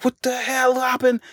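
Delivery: high startled "what the hell happened?"; a sudden high-pitched gasp of disbelief; shocked question. Retold or imitated. Grandmother stunned by the flying rock, asking high and startled. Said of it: "she looking at it like".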